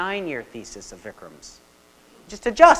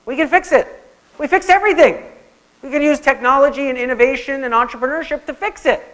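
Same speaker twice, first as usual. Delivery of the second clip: higher and louder, brash can-do confidence; gung-ho tech optimist; 8 semitones higher, 6 dB louder than usual. Retold or imitated. Lecturer mimics Silicon Valley boasting to the audience, brash and excited. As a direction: play Silicon Valley promising to fix everything. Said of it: "geoengineering is effectively Silicon Valley saying".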